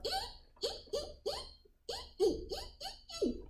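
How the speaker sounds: tiny, very high monkey ee-ee; monkey squeaks. Shown as a demonstration. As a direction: squeak softly like a cheeky monkey. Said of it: "be the monkey and go".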